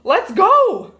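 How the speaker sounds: high, loud honk; Goosey Lucy honking eagerly; honking goose. A narrator's reading. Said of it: "honked Goosey Lucy".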